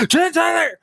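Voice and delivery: a high yelled name, imitating her; mock mother scream. Retelling how his mother yelled his name through the door, mock screaming. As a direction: shriek the name the way mom did. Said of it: "just screaming at me".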